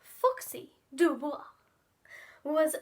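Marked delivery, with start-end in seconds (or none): none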